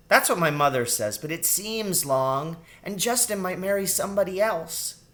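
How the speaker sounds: put-on worried little girl, pitch rising; fretting girl rat. A narrator's reading. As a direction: protest like a child who can't wait. Child rat frets about Justin.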